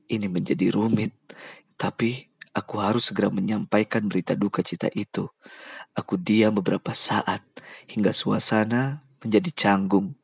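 plain reading voice

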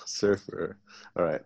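usual speaking voice

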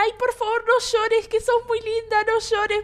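high, sweet soothing voice; loving comfort. Retold or imitated. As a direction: comfort a crying girl tenderly. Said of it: "me decía súper amorosa".

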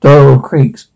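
plain reading voice

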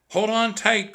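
high, loud urgent cry. A narrator's reading. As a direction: cry out to keep her holding on. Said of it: "cried Jack".

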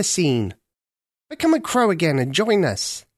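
put-on a chatty old bird inviting her along; senior crow. Senior crow coaxing Ketcha to the festival.

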